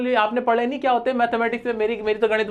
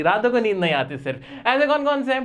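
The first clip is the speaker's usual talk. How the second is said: ordinary talk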